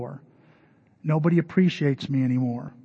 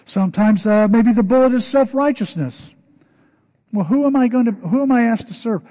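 full-force preaching; 7 semitones higher, 7 dB louder than usual. Acted. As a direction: press the question on them like a preacher. Preaching on self-righteousness.

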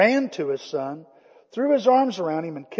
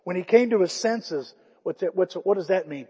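normal talking voice

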